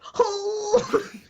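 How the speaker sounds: high, drawn-out labour moan; mock birth groan. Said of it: "what I'm like when I'm giving birth".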